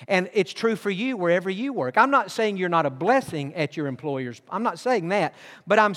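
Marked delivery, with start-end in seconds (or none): none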